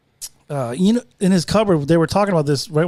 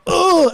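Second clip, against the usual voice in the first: pained grunt; 11 semitones higher than usual. Retold or imitated. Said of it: "I remember going".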